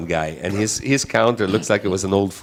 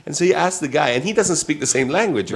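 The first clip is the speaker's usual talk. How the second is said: normal talking voice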